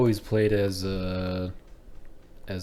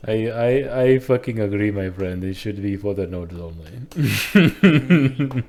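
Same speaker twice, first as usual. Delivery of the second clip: louder, hammed-up Indian-accented agreement; mock Indian accent; 6 dB louder, slower than usual. Friend backing the Nord's claim, mock Indian accent. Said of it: "He just turns it Indian".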